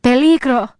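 high, loud warning cry; terrified shout. A narrator's reading. Terrified warning shouted once more.

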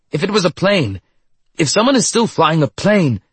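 high, urgent excitement; high-pitched, breathless-with-hope exclaiming; excited. A narrator's reading. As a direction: burst out eagerly, pitch way up. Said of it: "Doctor Zade continued excitedly".

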